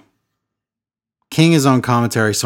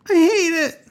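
high-pitched laugh through the words. Real, not acted. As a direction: laugh it out helplessly. Laughing at absurd wrestling booking.

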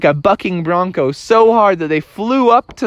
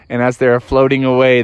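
ordinary delivery

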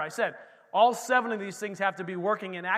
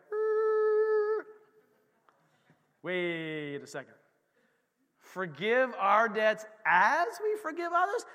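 slow, puzzled double take; taken aback; 2 semitones higher, 4 dB louder, slower than usual. Retold or imitated. Believer rereading the prayer, slow puzzled surprise. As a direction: stop short, unsure what you just read. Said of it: "You're like".

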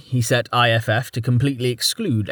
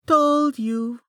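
lilting sing-song, much higher. A narrator's reading. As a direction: sing it out teasingly. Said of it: "in a sing-song voice".

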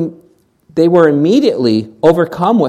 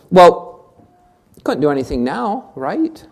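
ordinary talk

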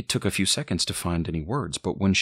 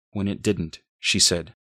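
ordinary delivery

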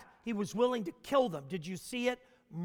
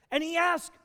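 ordinary delivery

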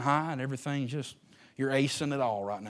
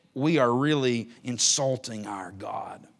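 usual speaking voice

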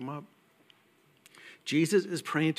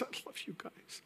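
a breathless, nearly silent whisper; tearful whisper; 8 semitones higher, 15 dB quieter than usual. Retold or imitated. Pastor retelling a sick member's tearful thanks, whispered. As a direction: voice the sick woman's thanks through tears, barely audible. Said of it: "she starts crying".

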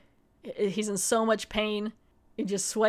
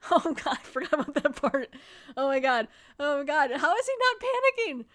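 laughing, climbing much higher into a squeal of disbelief; real gross-out; 5 semitones higher than usual. Real, not acted. Host remembering the eyeball scene, squealing disbelief. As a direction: caught off guard by the gore, half laughing.

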